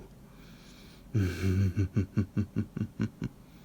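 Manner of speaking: sinister chuckle. Acted. Vampire gloating over his captive pet, sinister chuckle. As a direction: chuckle darkly at your prey.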